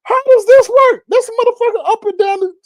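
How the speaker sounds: very high, comic bewilderment; acted baffled amazement; intrigued disbelief. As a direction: marvel at it with the voice way up. Retold baffled fascination.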